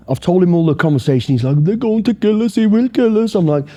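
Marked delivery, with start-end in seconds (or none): 1.6-3.3 higher-pitched imitation of a frightened friend; lightly voiced panicking mate; mock panicked friend. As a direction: whine it like a scared friend.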